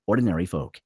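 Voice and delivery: ordinary talk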